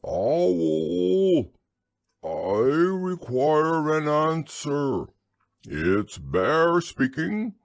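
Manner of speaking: slow, high calling-out; loud, drawn-out summons. A narrator's reading. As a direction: call loudly at the door, drawing the words out. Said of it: "in a very loud voice".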